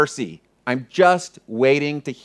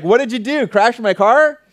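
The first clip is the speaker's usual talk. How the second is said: higher-pitched teasing question, imitating a friend; joking; 7 semitones higher than usual. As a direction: kid around in a raised, incredulous voice. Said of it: "And it was a joke".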